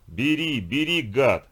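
громко, с яростью; брось слова, как швыряешь шарики; злой крик. Acted. (злой громкий крик)

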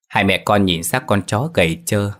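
ordinary delivery

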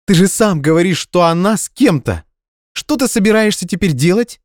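high, loud exasperated voice; indignant demand. Acted. Indignant girlfriend demanding why he leaves, high and loud. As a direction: demand an explanation.

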